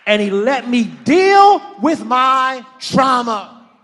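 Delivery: high, loud pulpit cry; impassioned preaching. Real, not acted. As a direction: call the congregation out at full volume. Preaches at full force.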